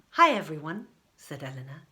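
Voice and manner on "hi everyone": bright, louder hello to the group; cheery greeting. Acted. Eleanor greets the schoolchildren outdoors, calling out loudly. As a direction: call out to the whole class.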